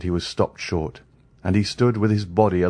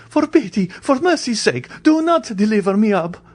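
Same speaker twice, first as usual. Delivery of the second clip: high, loud pleading; frantic begging; 4 dB louder than usual. A narrator's reading. Andrea begging the ladies not to betray him, loud. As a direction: plead for mercy. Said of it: "clasping his hands".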